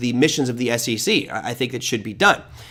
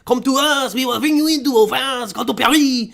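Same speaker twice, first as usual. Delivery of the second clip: exaggerated French accent, climbing high in pitch; mock French welcome, accent laid on thick and high; 7 semitones higher than usual. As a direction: invite them over in a hammed-up French accent. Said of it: "do it in a French accent".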